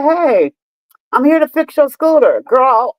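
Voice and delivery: lightly acted workman's announcement; imitated repairman. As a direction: pitch it up as the repair guy calling out.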